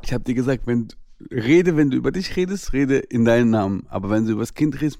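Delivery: ordinary talk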